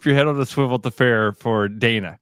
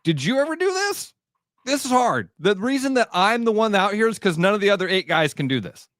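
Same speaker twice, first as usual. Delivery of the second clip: put-on frustrated kid; 6 semitones higher than usual. Kid on mound answering parents.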